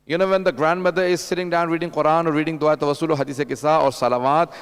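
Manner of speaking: ordinary delivery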